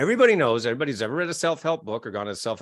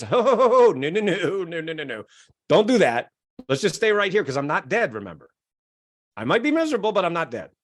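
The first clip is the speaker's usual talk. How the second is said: voicing the scared brain; 2 semitones higher, 5 dB louder than usual. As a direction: play the brain begging you to stay put. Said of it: "it says".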